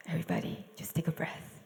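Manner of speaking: soft unvoiced calming whisper; soothing hush; gentle whisper. Real, not acted. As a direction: breathe it to the room.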